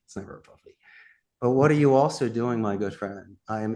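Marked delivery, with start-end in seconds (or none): 0.0-0.7 breathy whisper, a sly confidential aside. Acted. Coach whispering to listeners that the dog is never a puppy. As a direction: drop to a hushed whisper like sharing a secret.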